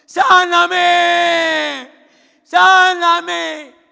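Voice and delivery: very high, drawn-out shouted cry; hammed-up begging, stretching each cry; mock desperate plea. Preacher mimics Saulo begging for healing, exaggerated high wail. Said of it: "Decía".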